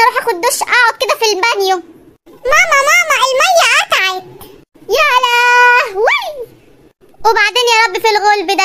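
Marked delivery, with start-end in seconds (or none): 2.5-4.2 put-on high child voice calling mama; a kid whining for his mother. Son calls his mother about the water, whining. 5.0-6.2 slow, drawn-out shout of "يا لهوي"; dismayed shout. Acted. Mother cries out at the water cut, shouting.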